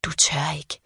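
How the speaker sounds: a creepy doll's hushed taunt, in a voice unlike her own; doll whispering. A narrator's reading. The doll Rose dares the girl, in a soft eerie whisper. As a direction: hiss it softly like a haunted doll. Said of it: "jeg kan høre hende hviske".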